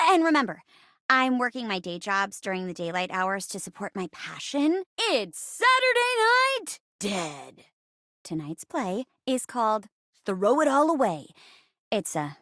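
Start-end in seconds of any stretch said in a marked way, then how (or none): 5.0-7.7 high, drawn-out show title; theatrical announcer. Acted. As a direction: announce it slowly, rising high, then drop on the last word. Host announces the mystery show.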